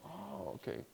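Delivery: soft, high "oh, I see"; dawning realisation. Retold or imitated. Beginner finally understands the script, sheepish realisation. He suddenly understands how to read.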